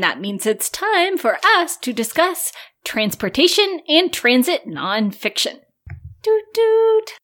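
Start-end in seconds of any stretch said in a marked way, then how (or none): 6.2-7.1 high, loud doot doot; train horn imitation.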